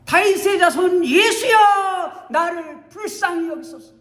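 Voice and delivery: high, loud shout for mercy; loud cry. Acted. Blind Bartimaeus shouting to Jesus passing by. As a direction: call out with all his strength. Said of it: "크게 소리칩니다".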